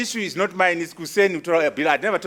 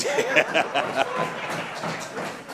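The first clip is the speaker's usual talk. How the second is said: long breathy laugh; 3 semitones lower, 5 dB quieter than usual. Real, not acted. Laughs at own joke.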